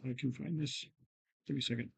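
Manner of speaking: quiet, under-projected muttering to oneself; mumbling. Real, not acted. Meeting host searching for the updates, mumbling quietly. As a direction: mumble softly into the phone, not projecting. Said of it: "you're mumbling into your phone".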